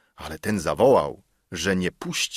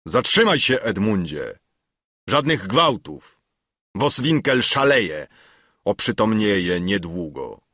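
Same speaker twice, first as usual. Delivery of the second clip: commanding, raised voice; powerful voice; 4 dB louder than usual. A narrator's reading. Leonard stops Edmund from the doorway, commanding. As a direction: call out forcefully to halt a fight. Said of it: "potężny głos Leonarda".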